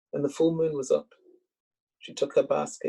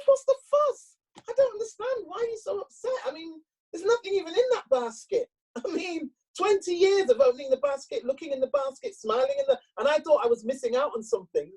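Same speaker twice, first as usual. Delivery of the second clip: high, bewildered husband voice much higher than the teller's own; husband's protest; 14 semitones higher than usual. Acted. Husband baffled by his upset wife, high and protesting. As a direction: pitch the husband way up, baffled and defensive.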